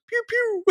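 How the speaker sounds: very high squeaky pew pew, quiet; squeaky laser pew. Retold or imitated. The guy in the show mimics a Space Invader laser, squeaky and high. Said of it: "the guy goes".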